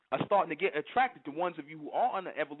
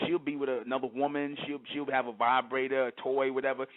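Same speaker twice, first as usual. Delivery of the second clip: ordinary delivery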